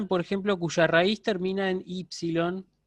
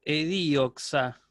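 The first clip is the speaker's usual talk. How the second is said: "edioxa" stretched slowly, syllable by syllable; slow spelled-out form; 3 semitones lower, much slower than usual. Shown as a demonstration. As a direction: lay out "edioxa" slowly for the class. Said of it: "Los griegos no escribían". ("edioxa" muy lento, sílaba por sílaba)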